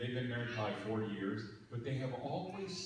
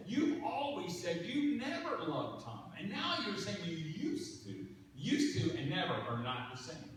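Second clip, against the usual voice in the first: high, louder pressing disbelief; incredulous insisting; 7 semitones higher, 4 dB louder than usual. Retold or imitated. Pastor pressing his friend Vicki, higher and louder in disbelief. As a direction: catch her out, pointing it home.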